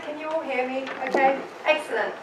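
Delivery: ordinary talk, nothing marked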